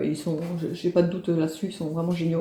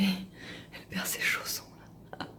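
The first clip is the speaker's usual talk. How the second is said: whisper; 15 dB quieter than usual. Real, not acted. Mother whispers so her napping baby stays asleep. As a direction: whisper so you don't wake her.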